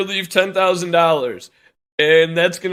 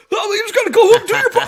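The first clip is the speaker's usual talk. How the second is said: shrill, much higher mock whine; 7 dB louder, faster than usual. Plumber mimicking stay-home workers, high mocking whine. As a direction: parody their smug slogan.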